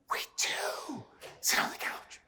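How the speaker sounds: high, slow, hushed whisper; drawn-out mock whisper; acted awed whisper. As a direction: whisper it slowly as an amazed group confession. Group admitting a lazy habit.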